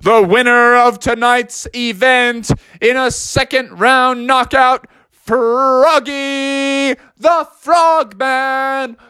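high, drawn-out winner announcement; grand boxing ref proclamation, last word held long; ring announcer. Acted. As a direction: roll and stretch the name like a fight announcer. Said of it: "the ref came up to Froggy and said".

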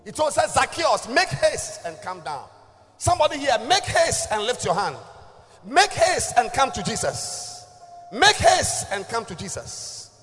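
high, loud, slow fiery preaching; preaching at full force. Acted. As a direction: call them forward, booming.

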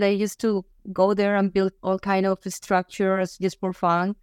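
ordinary talk

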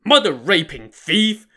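furious curse while punching; cursing. A narrator's reading. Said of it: "raised his right fist and cursed".

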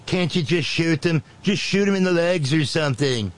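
high, whiny caricature of a boss; Nitwit Nero. Acted. Boss pressing his general, high mock whine. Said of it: "he turns to Mark Milley and says".

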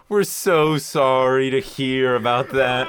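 slow, drawn-out fake sympathy; mock apology. Cable company staff savor a customer's trouble, falsely sorry. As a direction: stretch out an insincerely sorry line. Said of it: "when they start saying they're sorry".

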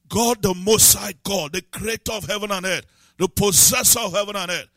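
full-throated preacher climbing in power; rising preaching. Real, not acted. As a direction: preach it with mounting force. Preaching build.

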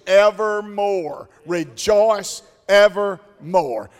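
slow, drawn out, each word weighted, Rejoice evermore; slow emphatic recitation. Shown as a demonstration. Preacher teaching the verse to the church, slow and weighty. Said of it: "Can you say that with me".

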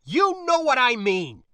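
loud, high-pitched outburst; shout. Acted. Spacer shouting at niece in the bar, frustrated. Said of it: "so the shout drew dark, suspicious glances".

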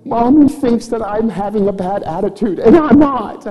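put-on high, indignant little-girl voice; put-on kid voice, building to a squeal; Chloe. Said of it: "she said".

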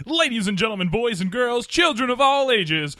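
high, loud, stretched announcer call; radio announcer voice. Pretend. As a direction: boom it out like a radio host opening the show. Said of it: "slip into radio guy".

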